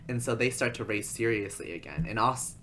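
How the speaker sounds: ordinary delivery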